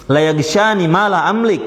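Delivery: drawn-out recited phrase; measured Arabic chant; slow Arabic recitation. Retold or imitated. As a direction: intone it slowly. Repeats the Arabic phrase slowly.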